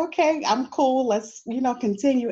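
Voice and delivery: teacher pretending it doesn't bother her; mock breezy. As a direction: act cool and move on. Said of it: "I said".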